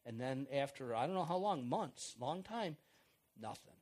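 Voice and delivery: usual speaking voice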